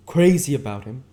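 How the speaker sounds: loud, high disbelieving echo; incredulous cry. Acted. Myrtle scoffs at Catherine, loud. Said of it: "cried Myrtle incredulously".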